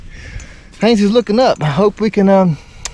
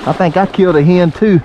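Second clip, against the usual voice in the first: ordinary delivery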